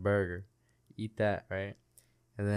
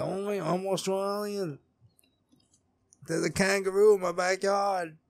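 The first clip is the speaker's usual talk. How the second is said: mock Australian; 10 semitones higher than usual. Podcaster mocking an Australian actor, exaggerated high Australian accent. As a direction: put on a silly high-pitched Australian voice. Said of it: "He's like".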